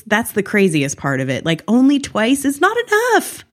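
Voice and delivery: usual speaking voice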